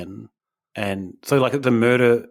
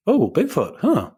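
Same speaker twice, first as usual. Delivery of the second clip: surprised; 9 semitones higher than usual. Acted. Mock TikTok viewer reacting.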